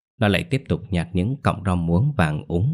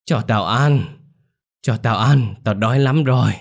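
higher, louder ravenous crone; hungry old crone; 4 semitones higher, 4 dB louder than usual. A narrator's reading. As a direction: beg for food like a ghoul. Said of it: "tiếng thều thào của bà cụ".

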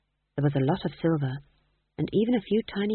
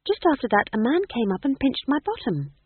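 put-on embarrassed girl; 8 semitones higher than usual. A narrator's reading. Embarrassed Magrat.

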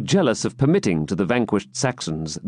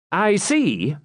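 jester voice; 9 semitones higher than usual. A narrator's reading. Wamba speaks.

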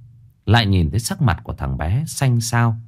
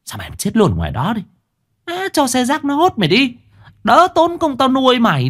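mother screaming at her feverish son; scolding mother; 12 semitones higher than usual. A narrator's reading. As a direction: spit it at the boy with contempt.

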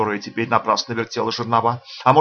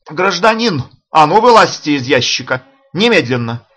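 loud, higher commanding shout; 4 semitones higher, 6 dB louder than usual. A narrator's reading. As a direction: bark the order out loud. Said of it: "крикнул милиционер". (громкий окрик)

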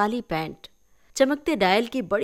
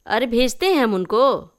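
a bit higher, louder elder voice; village elder; 4 semitones higher, 5 dB louder than usual. Acted. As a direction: brush it off casually. (गाँव वाले बुज़ुर्ग की हल्की ऊँची आवाज़)

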